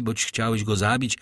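usual speaking voice

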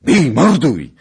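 high, very loud shouted charge; full-voiced attack yell; ringing battle cry. A narrator's reading. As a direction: roar it out across a ravine. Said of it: "zabrzmiało przy jednym ujściu wąwozu".

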